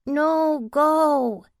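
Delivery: put-on high, loud little-boy yell; cling and cry out no; child's yell. Sick boy yelling at his mother not to leave, loud. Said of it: "he yelled".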